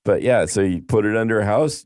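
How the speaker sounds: usual speaking voice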